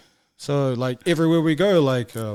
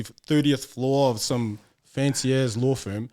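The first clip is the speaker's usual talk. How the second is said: ordinary delivery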